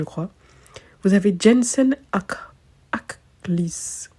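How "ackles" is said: "Ackles" whispered, unvoiced and tentative; unsure whisper. Shown as a demonstration. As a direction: whisper "Ackles" as a hesitant retry. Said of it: "j'ai mal prononcé celui-là".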